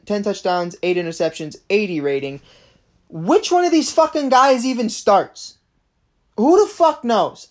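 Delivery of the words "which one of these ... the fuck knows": furious rant. Real, not acted. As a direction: blow up at the Bears front office. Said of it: "I'm swearing".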